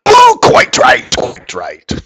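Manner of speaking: mock English accent, slow squeaky-high start falling away. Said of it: "the animal always has an English accent".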